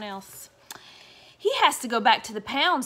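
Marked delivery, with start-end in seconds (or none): 1.4-2.8 louder stern dad voice; stern father. Acted. Father telling the kids the dog goes, stern and loud. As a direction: lay down the law as Father. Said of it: "said Father".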